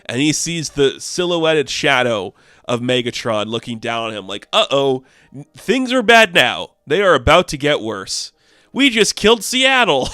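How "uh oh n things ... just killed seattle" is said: higher, louder gleeful announcement; gleeful mock alarm. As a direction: revel in the disaster out loud. Megatron gloating over destroyed city.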